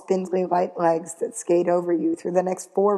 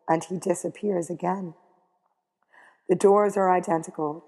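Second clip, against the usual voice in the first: ordinary delivery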